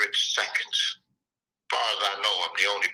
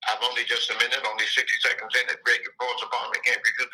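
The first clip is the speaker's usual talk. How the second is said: poem lines rushed into one breath; too fast; faster than usual. Shown as a demonstration. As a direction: rattle it off quickly with no pauses. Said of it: "So let me slow down".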